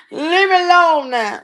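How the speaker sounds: laughing through the plea. Pretend. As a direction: giggle while begging.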